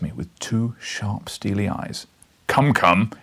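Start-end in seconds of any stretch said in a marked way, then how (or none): 2.5-3.1 loud, impatient "come, come"; rumbled. A narrator's reading. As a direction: rumble it, pressing him. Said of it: "he rumbled".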